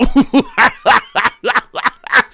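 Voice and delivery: high, loud maniacal cackle; Joker laugh. A narrator's reading. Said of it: "Joker laugh".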